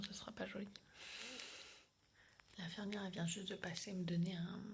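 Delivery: hushed whisper throughout. Real, not acted. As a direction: keep it to a whisper. Whispering before surgery.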